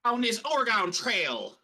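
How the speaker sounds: put-on drawn-out old-time prospector accent; Deuce Chiggins. Co-host opens his Oregon Trail bit for the panel, prospector voice. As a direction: put on a hammy prospector voice, slowly. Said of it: "I don't know what accent I'm doing".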